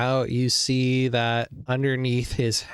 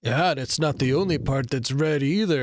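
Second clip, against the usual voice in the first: sleazy flirt. Acted. GM plays flirting guard.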